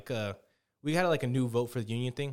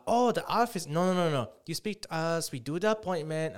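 higher and louder, pushy mock Mexican accent; mock Mexican receptionist; 4 semitones higher, 4 dB louder than usual. Caller mimics the receptionist refusing to transfer, in a mock Mexican accent. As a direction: play a curt Mexican receptionist brushing him off. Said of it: "this Mexican bitch was like".

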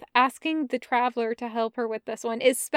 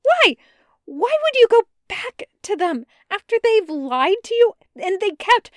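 much higher, much louder, desperate cry; desperate shout; 9 semitones higher, 8 dB louder than usual. Acted. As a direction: shout it at his back. Jester shouting after Bren.

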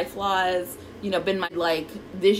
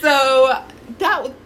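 ordinary delivery

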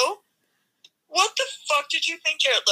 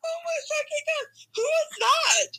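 shocked disbelief; 12 semitones higher, 3 dB quieter than usual. Real, not acted. Reacting to the boomerang guy.

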